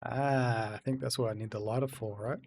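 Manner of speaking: normal talking voice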